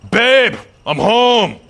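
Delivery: high, loud, slow husband's call; hammed-up homecoming shout, words stretched out; storming in. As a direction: bellow it through the house as you storm in. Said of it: "storming inside, being like".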